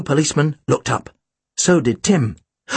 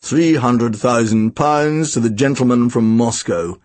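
low, slow plummy voice; plummy auctioneer, pitched low and drawn out; 6 semitones lower, slower than usual. A narrator's reading. Auctioneer greets the room over intercom, plummy and slow. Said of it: "It was a plummy voice".

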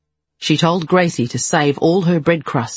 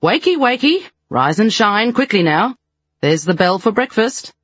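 high bright call to wake the room; bustling nurse rousing the girls; calling out orders; 6 semitones higher than usual. Acted. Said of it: "calling out orders".

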